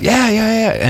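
high-pitched excited agreement; eager, high little burst of agreement; imitated eager recognition. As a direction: mimic someone suddenly recognizing you, pitch way up. Acting out officer's eager recognition.

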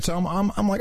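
ordinary talk

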